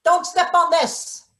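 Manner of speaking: called warning. Acted. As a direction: call it across the room.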